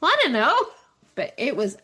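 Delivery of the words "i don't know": high, loud clueless reply; high and loud with a guilty shrug; sheepish shrug. Retold or imitated. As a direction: squeak it out, caught out. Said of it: "I'm like".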